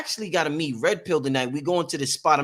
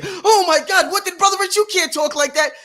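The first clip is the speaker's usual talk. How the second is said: shrill, much higher and louder pearl-clutching gasp; scandalized critics; 7 dB louder than usual. Retold or imitated. Host mocks people shocked by his language, scandalized. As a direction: play the offended prude, clutching pearls.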